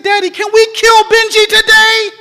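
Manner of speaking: put-on high, loud eager little boy; eager child. A narrator's reading. Preacher voicing the boy asking his dad, high and eager. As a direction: blurt it out like a keen child.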